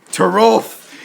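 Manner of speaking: hammed-up longing moan of his name. A narrator's reading. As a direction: moan Torolf like a pulp heroine, half tongue-in-cheek. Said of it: "Hilda moaned".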